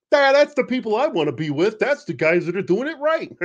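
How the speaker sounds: high mocking impression of a reenactor; mock goofy reenactor voice. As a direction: play the Civil War reenactor as a dim yokel. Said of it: "I just assume he talks like that".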